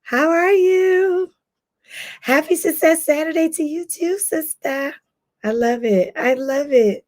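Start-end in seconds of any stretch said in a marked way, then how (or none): none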